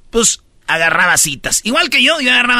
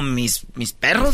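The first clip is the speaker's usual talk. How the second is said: timid whimper; 6 semitones lower, 5 dB quieter, slower than usual. Retold or imitated. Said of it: "Y él dijo".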